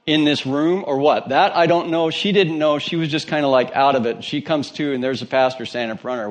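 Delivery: ordinary talk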